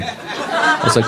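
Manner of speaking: ordinary talk, nothing marked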